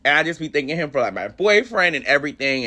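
ordinary talk

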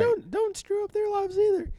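very high falsetto, mock pleading; hammed-up high-pitched protest; imitating panicked daughter. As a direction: plead in a squeaky put-on girl's voice. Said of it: "the daughter sees that, and she runs and says".

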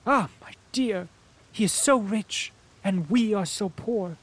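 high, loud lament to her son; pleading mother. Acted.

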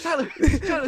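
loud high calling out. Pretend. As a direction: shout to get his attention. Fan calling the rapper.

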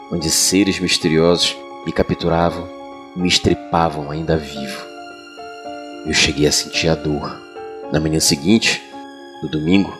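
ordinary delivery

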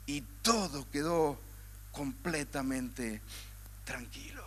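fading to near silence, slowed; hushed. A narrator's reading. Preacher describing the calmed sea, fading hush. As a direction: let the words die away like the calm sea.

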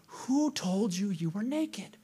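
slow, soft question from God; hushed questioning. Acted. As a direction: ask it slowly, almost whispered. God questions Adam after the sin.

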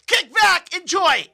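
excited hype. Real, not acted. Host hyping the show.

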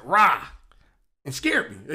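ordinary delivery

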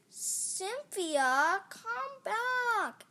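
high, loud, drawn-out call; demanding child. A narrator's reading. Little girl stomping for monster's return, demanding. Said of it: "Emma demanded".

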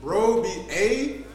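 a touch of Toronto slang, slowed down; lightly voiced Toronto accent, drawn out; slow Canadian-style phrase. Shown as a demonstration. Showing his old Toronto slang, a slow light Toronto accent. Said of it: "went from A to bro".

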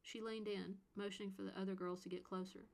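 plain reading voice

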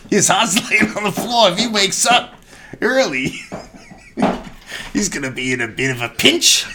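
laughing mock-fretful lady.

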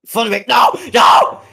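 loud high yell of no; shouting. Real, not acted. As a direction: yell it out in frustration. Shouting correction at friend.

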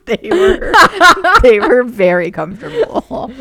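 laughing while confessing. Real, not acted. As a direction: giggle through the admission. Laughing while admitting knockoffs.

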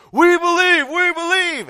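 very high, very loud chanted shout; full-voiced crowd roar; roaring in unison. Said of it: "they roared in unison".

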